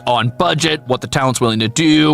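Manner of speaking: usual speaking voice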